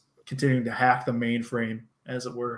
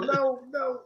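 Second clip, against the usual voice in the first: feeble moan; 12 semitones higher, 3 dB quieter than usual. Retold or imitated. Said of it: "He's just going".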